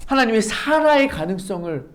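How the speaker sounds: normal talking voice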